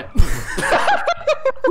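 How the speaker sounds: shrieking laugh; high burst of laughter. Real, not acted. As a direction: cackle out loud. Teammate knocked down. (tawa melengking)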